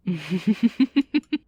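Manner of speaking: a short, knowing chuckle. Acted. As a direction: laugh to yourself, pleased. Villain amused by own plan.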